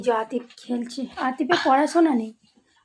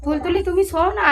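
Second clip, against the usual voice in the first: coaxing pet talk; 8 semitones higher than usual. Real, not acted. Mother coaxing the cat.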